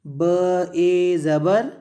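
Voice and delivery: very slow, drawn-out letter names; spelling out. Shown as a demonstration. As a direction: stretch each sound for learners. Teacher demonstrates spelling out letters.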